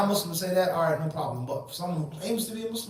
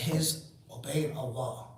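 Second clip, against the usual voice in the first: ordinary talk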